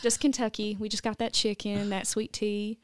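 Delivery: thickened Kentucky accent; Southern accent. Shown as a demonstration. Guest shows off hidden Kentucky roots, Southern accent. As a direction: lean into a homey Southern twang. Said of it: "can you say something with the accent".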